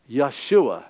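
Yeshua said as yah-SHOO-ah; Hebrew pronunciation. Shown as a demonstration. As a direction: say Yeshua the Hebrew way, stress on SHU. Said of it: "his Hebrew name is".

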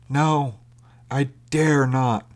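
high, slow, reluctant refusal; stifled craving. A narrator's reading. Starving stranger declining to come alongside, stifled craving. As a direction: refuse haltingly while aching to accept. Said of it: "a note of stifled craving".

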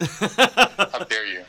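slow mock indignation; mock outrage. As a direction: draw it out, playfully offended.

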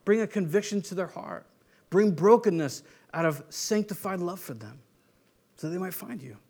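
hushed earnest intercession; quiet prayer. Acted. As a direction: pray it tenderly, under your breath. Prays aloud for neighbor.

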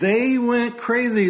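worked-up outburst. Real, not acted. Co-host ranting about press reaction.

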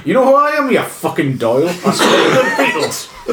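hammed-up northeastern accent leaping to a very high squeal; exaggerated northeastern English accent. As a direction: push the mock northeastern boast into squeaky absurdity. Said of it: "your impression of [name]".

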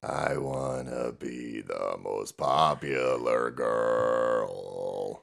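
low, slow gravelly rasp; swallowed voice. Real, not acted. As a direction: voice like a swallowed frog. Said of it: "You sound as if you swallowed a frog made of gravel".

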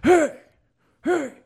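high loud call after someone; bouncer yelling. Retold or imitated. Bouncer yelling at a runaway, loud and high. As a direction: yell after a guy running off. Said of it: "he goes".

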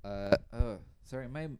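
mic cutting out; call dropping out. Real, not acted. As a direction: signal dropping in and out. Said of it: "my mic keeps cutting out".

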